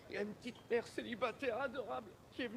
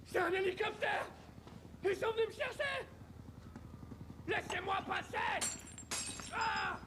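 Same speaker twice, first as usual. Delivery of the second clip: frantic shouting; 10 semitones higher than usual. Acted. Man calls to a rescue helicopter, shouting frantically. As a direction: shout wildly to be noticed by rescuers.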